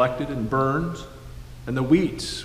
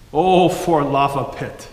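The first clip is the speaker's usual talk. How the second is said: put-on great-grandpa's old country accent, said fondly and a bit louder; 6 dB louder than usual. Great-grandson echoing great-grandpa's marvel in old country accent. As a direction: lean into a thick old country accent on lava pit. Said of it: "in his old country accent".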